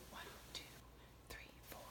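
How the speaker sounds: counting in a whisper. Shown as a demonstration. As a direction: breathe the numbers out softly. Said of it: "whisper count".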